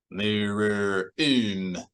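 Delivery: very slow, rumbled alien words; mumbling bug. A narrator's reading. Bug guard answering through the wall, slow mumbled rumble. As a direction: drone it out like a muffled insect. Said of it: "so softened by the wall that the words seemed mumbled".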